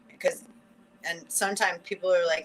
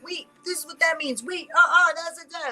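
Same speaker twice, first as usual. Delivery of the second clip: high-pitched mock objection, loud on "uh-uh"; argumentative; 8 semitones higher, 7 dB louder than usual. People arguing over what words mean, argumentative. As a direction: cut in with an insistent, high protest.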